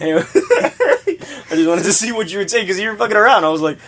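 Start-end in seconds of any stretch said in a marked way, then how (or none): 0.3-1.4 high-pitched giggle; high laugh. Real, not acted. Laughs at his own prank.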